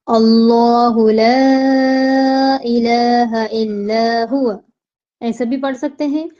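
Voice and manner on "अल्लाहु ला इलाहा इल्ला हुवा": slow, drawn-out tajweed chant; Quranic recitation. Shown as a demonstration. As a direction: recite it slowly, stretching each vowel. Recites Ayat al-Kursi.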